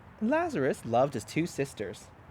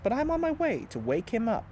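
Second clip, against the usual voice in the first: Jesus announcing; 8 semitones higher than usual. A narrator's reading. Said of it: "Jesus announced".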